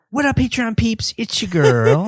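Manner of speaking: high, loud mock show greeting; imitating co-host's catchphrase. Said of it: "you could be the god of Cam".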